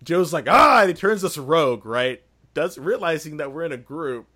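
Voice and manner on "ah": sudden yell, much higher and louder; startled yell. Retold or imitated. Joe yelling as Coco Nice ambushes them, loud cry. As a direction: blurt a short angry cry. Joe reacting to the rogue attack.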